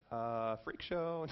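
low, slow, softly drawn-out judgement; acted quiet disapproval; mock dismay. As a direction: mutter it slowly, taken aback. Acts out friend walking in.

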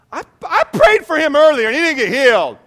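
high, very loud mock wail of complaint; hammed-up jealous protest, shouted high; jealous outburst. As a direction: cry out the grievance at full volume. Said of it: "ever been jealous of that person".

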